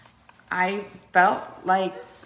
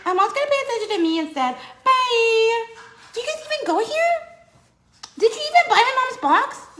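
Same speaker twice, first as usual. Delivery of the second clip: put-on much higher, louder, fast baby voice; voicing the baby; 5 dB louder than usual. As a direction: sass the viewers as the baby.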